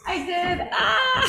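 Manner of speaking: sudden high startled cry; surprised yelp. Real, not acted. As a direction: caught off guard, blurting it out. Unplugged the mic by accident.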